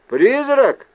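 loud, high-pitched shout; calling out. A narrator's reading. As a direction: shout the name to summon someone. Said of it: "крикнул я". (громкий высокий окрик)

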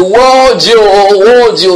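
high, loud mock wail to God; imitated crying out. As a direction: cry out as if calling on God. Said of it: "l'autre crie que quoi".

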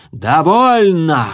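slow, loud growled dragon bark; dragon roar. A narrator's reading. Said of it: "рыкнула мадам Жаропых".

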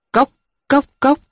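high clipped tok tok tok of a wooden bell; wooden knock imitation. Crier's wooden bell beating, high vocal knocks.

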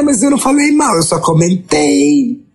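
high, prim voice playing innocent; pretending she never gossiped; mock innocent self-quote. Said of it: "eu tô falando e penso assim".

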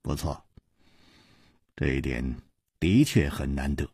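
low, soft, dry agreement; 淡淡微笑; mild smiling reply. A narrator's reading. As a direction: humour him quietly. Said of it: "楚留香微笑着说".

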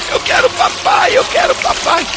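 put-on little girl crying. A narrator's reading. Little sister crying for her dad as water rises, wailing. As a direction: sob it out like a terrified toddler. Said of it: "a pequenininha começou a chorar".

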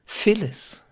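high, pained call of the name; full of pain. A narrator's reading. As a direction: sigh the name out in sorrow. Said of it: "voll unaussprechlichen Schmerzens".

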